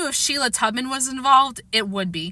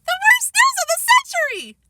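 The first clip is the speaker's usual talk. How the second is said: even higher mocking copy of her wail; imitating Sheila; 3 dB louder than usual. A narrator's reading. Fudge mocking Sheila's outburst, shrieking imitation. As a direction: ape her wail back at her. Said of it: "doing a perfect imitation of Sheila".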